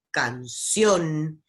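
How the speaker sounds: canción with a strong, drawn-out CIÓN; final-syllable stress. Shown as a demonstration. Teacher giving examples of agudas, final stress on canción. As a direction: hit the end of canción. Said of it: "tienen la última sílaba".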